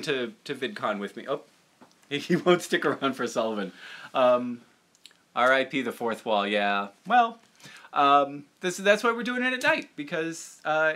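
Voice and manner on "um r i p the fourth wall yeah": usual speaking voice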